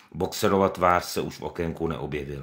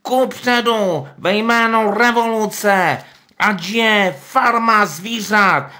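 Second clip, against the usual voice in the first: much higher, slow, louder pig voice; Pištík; 10 dB louder, much slower than usual. Acted. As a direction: put on a tearful show of grief. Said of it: "šeptal".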